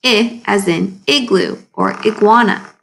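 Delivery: ordinary talk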